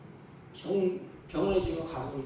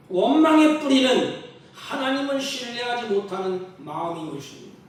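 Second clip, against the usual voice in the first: louder preaching restatement; 4 semitones higher, 9 dB louder than usual. Shown as a demonstration. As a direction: repeat the line louder, stress on 원망의.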